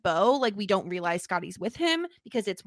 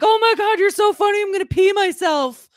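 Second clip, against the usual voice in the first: high, loud gushing outburst; mock hysterics; 8 semitones higher, 9 dB louder than usual. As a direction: act out over-the-top amusement at full volume. Acted quote of mocking character.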